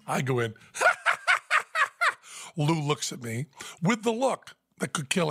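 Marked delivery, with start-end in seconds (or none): 0.7-2.1 high, loud cackling witch laugh; a shrieking hag's cackle; horrible witch cackle. Shown as a demonstration. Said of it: "that horrible witch cackle".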